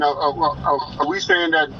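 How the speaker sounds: usual speaking voice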